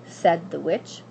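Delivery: plain reading voice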